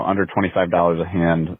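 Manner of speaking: usual speaking voice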